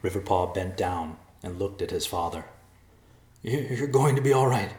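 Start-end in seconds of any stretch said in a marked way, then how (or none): 3.4-4.7 high, loud, stammering reassurance; distraught reassurance. A narrator's reading. Riverpaw bends over his dying father, distraught. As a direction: insist through rising distress.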